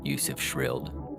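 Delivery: usual speaking voice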